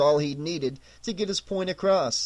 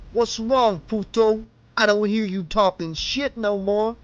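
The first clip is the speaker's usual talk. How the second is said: higher sneering thug voice; thug taunting; 5 semitones higher than usual. A narrator's reading. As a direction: gloat over the man on his knees.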